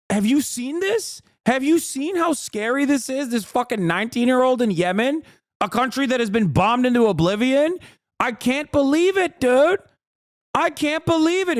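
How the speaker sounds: high, sarcastic fake shock; mock alarm. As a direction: pretend to be scandalised, dripping irony.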